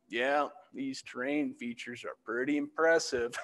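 slow, unimpressed imitation of a coworker; mock nonchalant drawl; whatever tone. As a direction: say it slowly, as an offhand understatement. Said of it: "oh, whatever tune".

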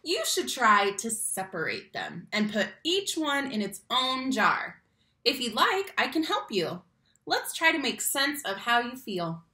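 lively, expressive picture-book reading; reading to children. Acted. Teacher reading a picture book to her class, expressive storytime voice. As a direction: read it like storytime for little kids.

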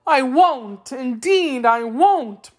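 high, loud, drawn-out promise; promising, cheering up. A narrator's reading. Wheeler vowing to Dorothy, high earnest promise. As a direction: promise eagerly in a high creature voice. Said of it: "promised the Wheeler, ceasing to cry".

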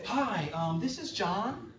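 mock polite phone greeting, lifting in pitch at the end. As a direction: put on a telephone voice. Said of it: "You know, telephone voice".

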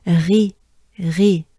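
"Ri" with a throaty French R; "Ri" as a French pronunciation demo. Shown as a demonstration. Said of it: "French R".

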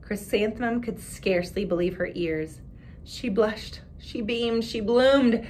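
put-on expressive read-aloud for children; story narration. A narrator's reading. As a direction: read the picture book to the class with voices. Reads picture book aloud.

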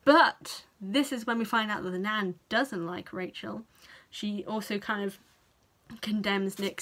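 normal talking voice

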